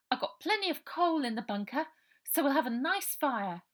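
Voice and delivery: cheerfully change the subject; girl Ida. Acted. Ida distracting hungry Robert with talk of a fire, high girl voice.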